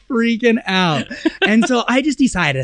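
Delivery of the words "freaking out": freaking out. Retold or imitated. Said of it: "And I'm, like".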